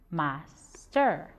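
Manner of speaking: "Master" split in two with a clear pause; syllable by syllable. Shown as a demonstration. Teacher showing pupils two syllables in "Master", measured and separated. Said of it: "We get two syllables".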